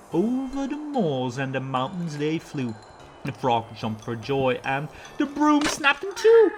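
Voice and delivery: ordinary delivery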